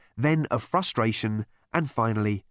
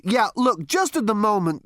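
flustered interruption; 10 semitones higher, 5 dB louder than usual. Acted. Zaphod tries to interrupt.

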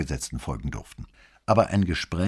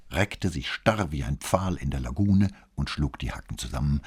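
ordinary delivery

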